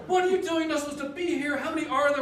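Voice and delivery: high, loud scolding shout; angry yell. Retold or imitated. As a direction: yell at the reckless kayakers. Angry lifeguards yelling at them.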